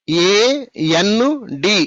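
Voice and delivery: AND very slow, a gap between letters; divided letters. Shown as a demonstration. Teacher showing pupils what not to do, AND slowly divided letter by letter. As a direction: break AND into drawn-out separate letters. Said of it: "divide చేయకూడదు".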